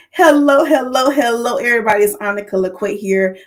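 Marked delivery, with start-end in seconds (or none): 0.1-2.0 big opening hello, starting high and loud; show greeting. Real, not acted. Host opening her anniversary livestream, excited greeting. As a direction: launch the show with ringing hellos. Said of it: "Let me do my greeting".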